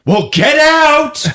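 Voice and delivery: high, loud, drawn-out order; hammed-up exasperated yell; acted shout. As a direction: holler him out of the room. Retold character ordering him out.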